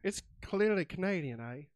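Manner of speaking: loud, bad attempt at a Canadian accent; botched Canadian accent. Host echoing the drink's name, botched Canadian accent. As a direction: ham up a muddled Canadian accent. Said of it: "I don't know what kind of accent".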